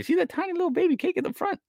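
put-on very high, quick baby voice; tiny cute voice. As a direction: squeak it like something tiny. Pointing at tiny cake.

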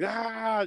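long, high-pitched imitated scream; mock battle scream. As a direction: scream like you're emptying a rifle. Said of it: "just screaming and shit".

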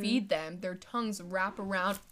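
ordinary talk, nothing marked

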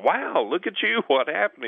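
high, amazed exclamation; amazed bus driver. Retold or imitated. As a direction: gush at him like he's a miracle. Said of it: "and the bus driver goes".

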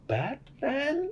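high, soft questioning squeak; mock-baffled high voice; puzzled mimicry. Mimics Nadia's clueless question.

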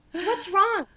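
high-pitched retold question; acted-out anxious asking; frantic worry. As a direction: plead to know, voice jumping up. Retelling frantic question to mom.

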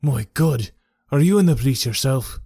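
put-on a touch of alarm in a crooked old man; lightly voiced veteran, startled; hint of a wary old soldier. A narrator's reading. Henry Wood alarmed.

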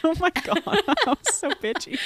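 high, loud laughing self-scold. Real, not acted. Host laughing at her own jab at her cohost, giggling high. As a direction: giggle through calling yourself out.

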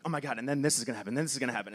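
fast, breathless rush of excitement; racing through it like a kid describing a new idea; rushed excited gush. Retold or imitated. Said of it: "I would start to talk so fast".

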